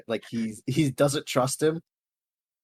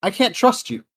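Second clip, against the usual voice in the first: loud, higher-pitched objection; raised-voice protest; 5 semitones higher, 8 dB louder than usual. Acted. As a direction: cut in loudly, acting out distrust. Acted confrontation between party members.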